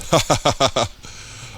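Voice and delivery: high jeering laugh; mocking laughter. A narrator's reading. Gang members mock Michael, jeering laugh. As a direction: laugh at the boy. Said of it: "A couple of gunrooms laugh".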